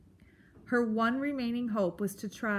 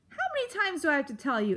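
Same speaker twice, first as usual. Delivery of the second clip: fast, raised exasperated voice; mild scream; 6 semitones higher, much faster than usual. A narrator's reading. Porcupine tired of repeating himself, mildly screaming. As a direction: rattle it off high and irritated. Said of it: "Aerith screamed".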